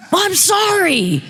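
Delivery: high-pitched, drawn-out yelled apology; retold shout. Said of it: "And so I shouted louder".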